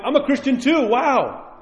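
imitated high-pitched amazed convert; excited. Onlookers converted by seeing other Christians, excited. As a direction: voice leaping up in wonder.

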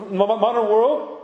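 ordinary talk

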